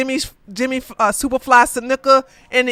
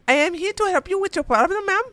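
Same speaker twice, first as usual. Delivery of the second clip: high, fast mock Indian call-center voice; lightly voiced Indian call-center agent; Indian accent; 8 semitones higher, faster than usual. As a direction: rattle it off like a scripted agent.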